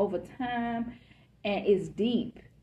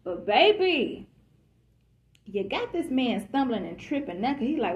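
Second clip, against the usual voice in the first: ordinary talk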